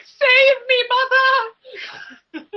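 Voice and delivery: high, loud melodramatic wail; hammed-up shriek of abandoned characters pleading; screaming characters. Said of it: "all those assholes screaming at me".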